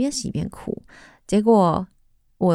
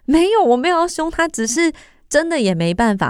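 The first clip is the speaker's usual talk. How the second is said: higher, protesting reply; defensive reply; 9 semitones higher, 3 dB louder than usual. Retold or imitated. Mother answering the scolding on the phone, defensive. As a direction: insist you meant no harm.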